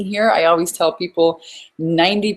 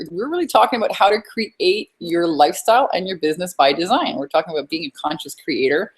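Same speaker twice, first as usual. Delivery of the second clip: usual speaking voice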